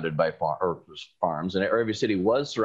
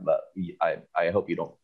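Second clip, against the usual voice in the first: ordinary talk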